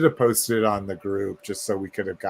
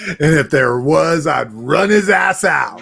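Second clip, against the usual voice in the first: joking shout; 4 semitones higher, 8 dB louder than usual. Pretend. Joking threat about running Gabe out.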